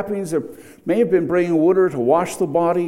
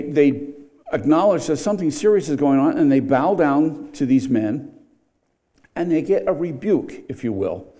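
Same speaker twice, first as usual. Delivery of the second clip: normal talking voice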